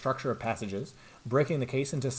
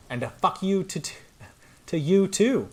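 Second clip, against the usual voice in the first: sassy defiance; 8 semitones higher, 4 dB louder than usual. Acted. Voicing the pen's slash.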